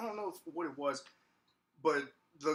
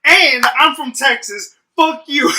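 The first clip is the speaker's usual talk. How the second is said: re-enacted furious shout, high and very loud; outraged comeback; 12 semitones higher, 22 dB louder than usual. Worker firing back at an insulting customer, shouting angrily. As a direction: yell it back in retold outrage. Retold angry retort to customer.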